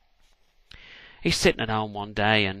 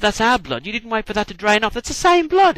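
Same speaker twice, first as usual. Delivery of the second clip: higher and louder, outraged at the cheat; indignant protest; 9 semitones higher, 7 dB louder than usual. Acted. Moses accusing the Pharaoh of reusing his blood, indignant. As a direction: protest hotly, rising to a squeal.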